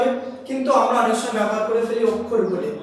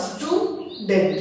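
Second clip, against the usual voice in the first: "Student" slowly, as stew, pause, dent; broken into syllables; 4 semitones lower, 3 dB quieter, much slower than usual. Shown as a demonstration. Teacher showing it cannot be said at once, "student" in two slow beats. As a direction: leave a clear gap inside "student". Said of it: "ভাঙা যাচ্ছে".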